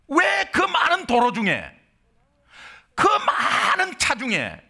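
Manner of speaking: a hint of grieving resentment; resentful complaint. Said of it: "어떤 원망이 나오냐". (원망하는 듯한 약간 높은 목소리)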